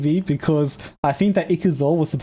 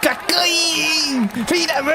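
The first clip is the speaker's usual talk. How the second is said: excited cheer; 11 semitones higher, much slower than usual. Retold or imitated. Echoing the crowd cheer.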